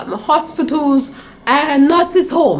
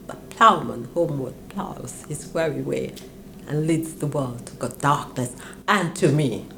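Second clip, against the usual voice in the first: poem recitation; 6 semitones lower than usual. Retold or imitated.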